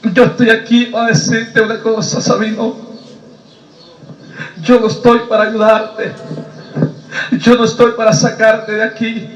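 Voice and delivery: measured reproach with long pauses; preacher voicing a grave God; solemn divine voice. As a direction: speak gravely as God, letting each phrase land. Voices God addressing Sabino.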